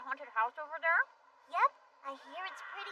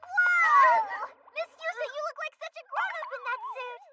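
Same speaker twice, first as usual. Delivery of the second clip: amazed; 11 semitones higher, 9 dB louder than usual. Acted. Amazed at friend's outfit.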